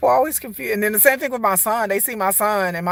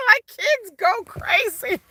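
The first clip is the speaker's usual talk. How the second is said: high, laughing delivery; 5 dB louder, slower than usual. Real, not acted. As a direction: talk through a burst of laughter. Laughs about kids' reaction.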